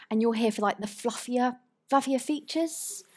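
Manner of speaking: mock a colleague's sugary put-down, rising at the end; imitated condescension. Ex-colleague belittling the presenter's role, condescending. Retold colleague patronising her.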